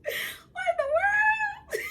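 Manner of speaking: high, slow squeal of laughter; can't believe the text, laughing; laughing disbelief. Real, not acted. Reading his text, she bursts out laughing. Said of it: "And I'm laughing".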